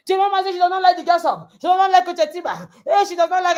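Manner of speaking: gossipy excitement. Retold or imitated. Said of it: "let me not tell you".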